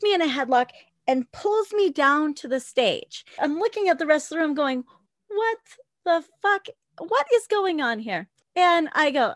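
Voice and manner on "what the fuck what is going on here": high-pitched, incredulous reenactment; retold bewildered disbelief. Dancer in a headlock appealing to the room, bewildered disbelief.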